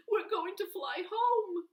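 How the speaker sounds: high, loud joyful cry; excited cry. Acted. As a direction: call out with delight. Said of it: "cries Howard".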